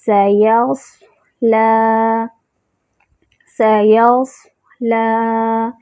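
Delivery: سیصلا very slow with light lam; tajweed demo. Shown as a demonstration. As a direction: stretch سیصلا keeping lam thin. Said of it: "صاد کے ساتھ لام موٹا نہیں ہو".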